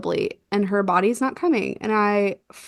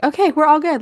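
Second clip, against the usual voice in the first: higher, louder relieved "we're all good"; relieved cheer; 7 semitones higher, 5 dB louder, faster than usual. Retold or imitated. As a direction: let the relief burst out. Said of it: "I was like".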